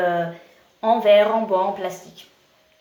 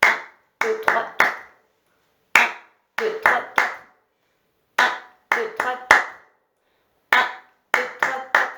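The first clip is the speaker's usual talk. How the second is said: called counts, louder; counting out the beat; 3 semitones higher, 5 dB louder than usual. Shown as a demonstration. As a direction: call out the count to keep time. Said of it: "on peut essayer".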